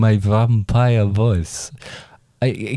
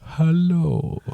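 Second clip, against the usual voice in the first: higher, drawn-out vampire hello; 7 semitones higher than usual. Acted. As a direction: greet them as a creepy vampire. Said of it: "I've managed to locate a vampire".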